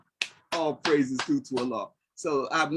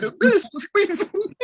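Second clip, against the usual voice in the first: laughing; 7 dB louder than usual. Retold or imitated. Retold hug, laughing.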